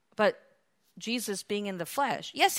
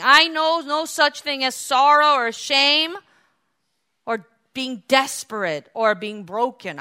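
loud, high, indignant questioning; acted high priest scoffing; mock incredulous; 8 semitones higher, 12 dB louder than usual. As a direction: fire off each question in loud disbelief. Acts an uncomprehending high priest.

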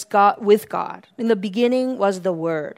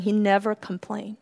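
ordinary talk, nothing marked

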